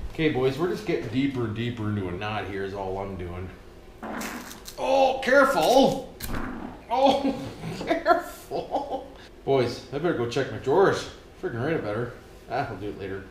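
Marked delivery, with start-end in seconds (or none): 4.8-9.1 laughing. Real, not acted. Laughing while working the cord.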